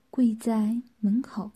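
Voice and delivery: ordinary delivery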